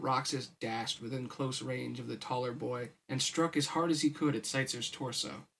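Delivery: usual speaking voice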